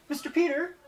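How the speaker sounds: put-on high, loud happy kid call; little kid spotting a favourite teacher; excited child.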